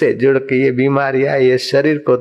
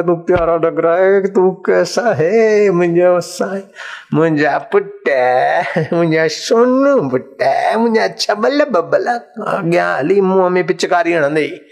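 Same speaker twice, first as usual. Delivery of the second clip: put-on baby talk; 3 semitones higher than usual. Doting parent cooing at a baby, in high baby talk.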